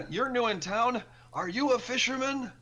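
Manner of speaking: put-on old fisherman voice. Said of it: "so he asks".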